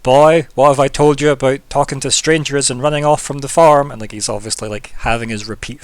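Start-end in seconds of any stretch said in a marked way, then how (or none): 0.0-3.9 scolding father. Acted. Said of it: "fatherly chat".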